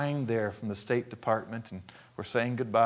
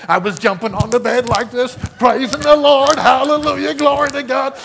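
high, loud joyful praise; loud, high preacher's praise; exuberant preaching; 10 semitones higher, 13 dB louder than usual. Acted. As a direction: shout your thanks like you're bouncing on the bed.

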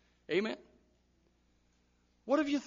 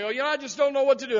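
higher, louder and fast, imitating a flustered person; mock helpless complaint; 7 semitones higher, 7 dB louder, faster than usual. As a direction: rush it out like someone at a loss. Pastor mimics confused congregant.

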